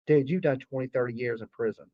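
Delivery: low, soft muttered thought; muttered aside. Retold or imitated. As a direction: say it under your breath. Retold inner thought about worker.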